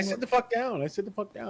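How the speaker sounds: nervous compliance. Pretend. Repeats to agree with gunman.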